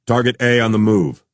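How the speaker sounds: brisk tactical announcement; announcing coordinator. A narrator's reading. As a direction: announce it to the team. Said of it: "the tactical coordinator announced".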